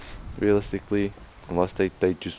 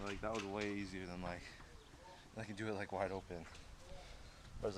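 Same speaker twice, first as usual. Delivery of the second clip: quiet; 17 dB quieter than usual. Real, not acted. Quiet stretching talk.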